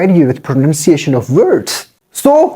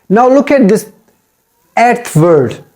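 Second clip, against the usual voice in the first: ordinary talk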